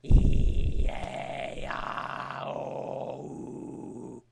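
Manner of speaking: raspy false chord vowels; loud distorted scream on each vowel. Shown as a demonstration. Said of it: "So, like".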